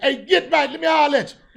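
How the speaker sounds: put-on an older man's high, loud street greeting; father's greeting. The father hailing Get Right, loud and high. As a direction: call out like a neighborhood dad. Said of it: "that's how my father would say".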